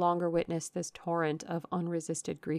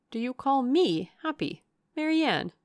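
higher, louder hurt question; pained protest; 6 semitones higher, 5 dB louder than usual. A narrator's reading. Elder sister answering being called happy, pained protest. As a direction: answer the reproach with pained feeling.

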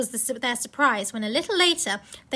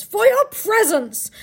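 high, loud, drawn-out proclamation; acted ceremonious voice; Father Christmas; 9 semitones higher, 6 dB louder, slower than usual. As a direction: announce a treat grandly and slowly. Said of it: "said Father Christmas".

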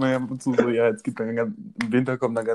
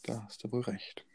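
whispering; 14 dB quieter than usual. Real, not acted. Soft aside in the conversation.

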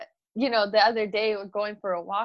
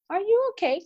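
put-on high, gentle little-girl question; sweet toddler voice; 12 semitones higher than usual. Mom voicing daughter asking if she is okay, sweet. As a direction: ask like a caring toddler.

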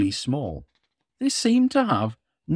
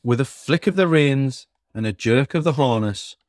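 usual speaking voice

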